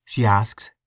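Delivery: ordinary delivery, nothing marked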